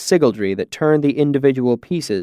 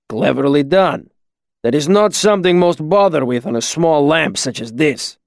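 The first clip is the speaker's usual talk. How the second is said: put-on accented master craftsman, measured praise; Kilvin, approving; 3 semitones higher than usual. Master praising student's switch, measured accented approval. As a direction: grant approval in a firm, foreign-accented voice.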